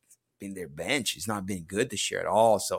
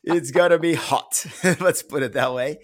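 laughing; 4 semitones higher, 4 dB louder than usual. Real, not acted. Laughs after accent teasing.

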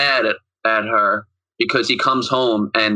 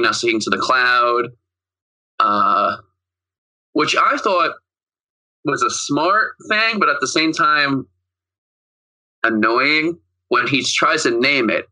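normal talking voice

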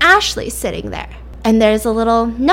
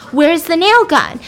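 higher, louder insistent demand; getting mad; 7 semitones higher, 5 dB louder than usual. Retold or imitated. Woman pressing the girl about the weapon, acted angry demand. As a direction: act out an angry, urgent question. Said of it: "she's getting mad".

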